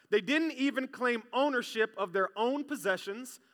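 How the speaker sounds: ordinary talk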